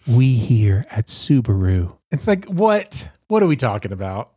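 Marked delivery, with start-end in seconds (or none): 0.1-1.9 slow, lightly voiced ad announcer; mock a solemn TV ad read; a hint of a somber car ad; commercial voice-over. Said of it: "And every commercial".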